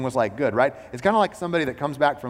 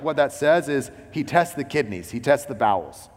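ordinary delivery

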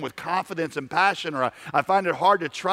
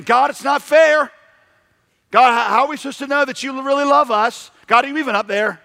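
complaining to God; 9 semitones higher, 7 dB louder than usual. Acted. People whining at God.

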